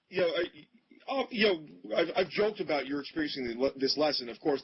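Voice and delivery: usual speaking voice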